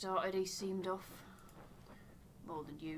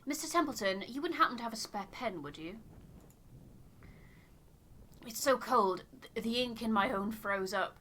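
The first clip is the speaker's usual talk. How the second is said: higher, louder voice of a settler asking a favour; a cold, polite settler voice pushed up and out; shivering settler; 3 semitones higher, 6 dB louder than usual. Acted. Voices settler Mister Templeton.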